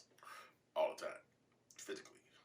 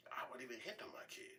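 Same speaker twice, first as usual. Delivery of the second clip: ordinary delivery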